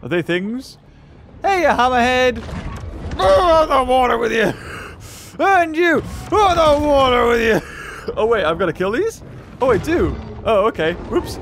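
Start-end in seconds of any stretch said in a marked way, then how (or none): 1.4-7.6 high, hollered taunt at enemies; mock battle shout. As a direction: holler as you fling foes aside. Calls out enemies while attacking.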